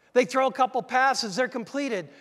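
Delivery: ordinary talk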